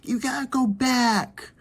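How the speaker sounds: high-pitched mock yell of a panicking mother; freaked-out parent ordering you home; freaked-out mom. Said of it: "she freaked out on the phone".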